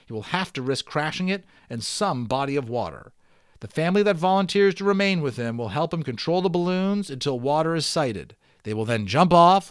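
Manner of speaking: steady read-aloud storytelling voice; story narration. Acted. Teacher reading the balloon novel aloud to students, storytelling narration. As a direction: read it like a chapter book to the class.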